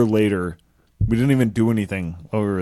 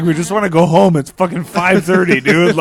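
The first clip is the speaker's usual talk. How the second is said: louder, higher, fed-up whine; begging to go home; exasperated plea; 7 semitones higher, 8 dB louder than usual. Retold or imitated. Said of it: "We're like".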